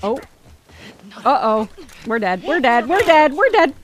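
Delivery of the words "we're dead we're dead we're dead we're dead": building to a loud, alarmed cry; mounting alarm. Real, not acted. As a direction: repeat it with growing panic. Sees defeat coming in game.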